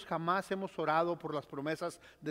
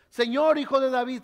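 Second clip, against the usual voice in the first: louder cry; 4 semitones higher, 8 dB louder than usual. Acted. Blind men shouting over the hushing crowd, louder. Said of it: "gritaban aún más fuerte".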